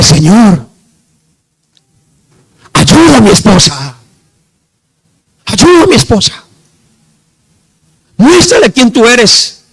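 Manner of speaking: loud, high cry to God; impassioned prayer. Retold or imitated. Husband praying for his wife over the phone, impassioned cry. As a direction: re-enact a desperate, forceful plea.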